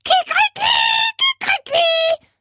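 very high, loud crowing; piercing cock-a-doodle-doo; screeching parrot. A narrator's reading. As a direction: screech it out like a parrot playing rooster. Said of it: "kreischte er".

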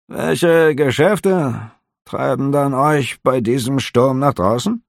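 high, curious innkeeper; a nosy innkeeper asking lightly; innkeeper's voice. A narrator's reading. Innkeeper questioning the officer, high and curious.

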